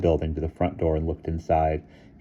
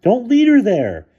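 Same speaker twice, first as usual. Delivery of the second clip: high loud pleading yell; desperate shout; 10 dB louder than usual. A narrator's reading. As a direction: shout over the din. Bruno shouting after the crew.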